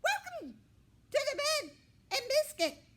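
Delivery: very high, loud squawky bird voice; mynah bird voice. A narrator's reading. Mynah bird greeting the new puppy, very high and squawky. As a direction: chirp it like a talking mynah. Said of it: "Gabby said".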